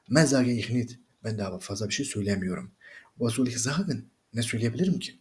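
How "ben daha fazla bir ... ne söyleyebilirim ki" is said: ordinary talk, nothing marked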